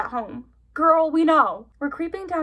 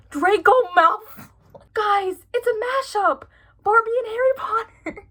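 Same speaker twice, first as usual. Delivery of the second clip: higher and louder, bursting with glee; excited; 8 semitones higher, 4 dB louder than usual. Real, not acted. Telling viewers the book is a mashup, excited and loud. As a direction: tell the viewers the big reveal, delighted. Recognizes the character, thrilled.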